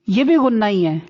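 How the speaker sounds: usual speaking voice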